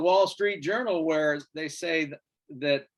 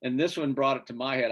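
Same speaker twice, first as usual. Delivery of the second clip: usual speaking voice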